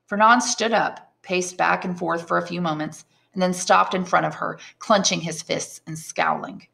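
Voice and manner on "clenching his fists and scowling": ordinary delivery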